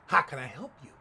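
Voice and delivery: low, loud mock challenge; imitating an unfriendly cashier; curt server. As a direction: demand it bluntly, without any welcome. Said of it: "looking at me with a head cocked".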